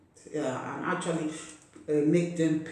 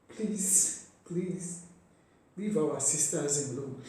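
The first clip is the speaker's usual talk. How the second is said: begging through real tears; choked-up appeal; tearful plea; 3 dB quieter, slower than usual. Real, not acted. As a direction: plead while still crying. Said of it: "I don't know why I'm crying".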